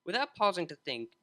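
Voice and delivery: ordinary delivery, nothing marked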